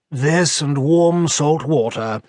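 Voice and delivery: slow, croaking and well-spoken; croaky refined voice. A narrator's reading. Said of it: "croaked a refined voice".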